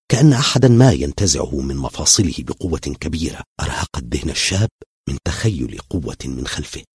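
ordinary delivery, nothing marked

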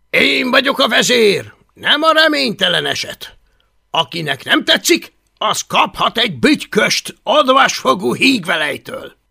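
put-on loud, high, swaggering boy leader; bossy bully leader. Boastful Viking boy bossing the others, loud and high. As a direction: bark orders like the self-made chief.

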